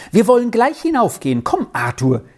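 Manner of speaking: quick, high-pitched urging; eager call. A narrator's reading. Excited girl urges her friend up to the house, eager call. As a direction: call a friend along, starting high and fast. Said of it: "rief sie".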